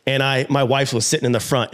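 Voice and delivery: ordinary talk, nothing marked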